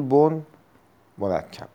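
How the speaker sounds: usual speaking voice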